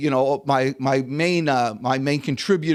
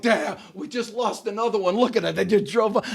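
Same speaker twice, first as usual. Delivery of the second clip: mock dismay; 5 semitones higher than usual. Imagined store staff lamenting lost driver.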